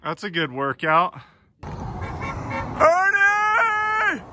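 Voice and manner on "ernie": calling out. Real, not acted. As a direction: call him from far away. Host calling out across farm.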